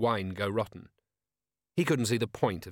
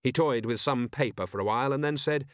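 ordinary delivery, nothing marked

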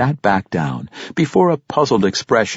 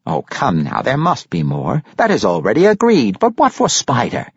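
grandfather voice climbing high and louder; coaxing Pericolo; 7 semitones higher, 4 dB louder than usual. Acted. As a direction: coax with building insistence.

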